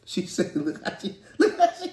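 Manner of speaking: cracking up mid-sentence; laughing. Real, not acted. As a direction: squeal through laughter. Laughing hard.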